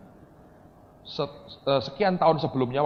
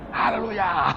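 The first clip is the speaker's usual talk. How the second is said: loud, raised hallelujah; pitched-up mock hallelujah cry; Timotius Arifin and Indri; 6 semitones higher, 5 dB louder, much slower than usual. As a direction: imitate a preacher's worn-out praise shout. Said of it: "Anda kayak Timotius Arifin dan Indri Gautama semua".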